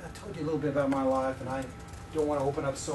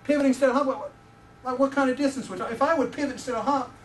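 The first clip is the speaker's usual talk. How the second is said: indignant disbelief; 7 semitones higher, 4 dB louder than usual. Retold or imitated. Retold indignant reply to coach.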